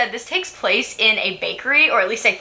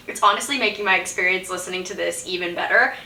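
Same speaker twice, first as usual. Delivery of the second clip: normal talking voice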